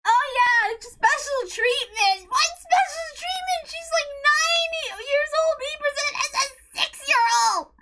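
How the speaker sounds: high sarcastic mock voice; mocking sarcasm. Fan mocking the special treatment excuse, sarcastic. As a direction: sneer it back at them. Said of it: "It's like".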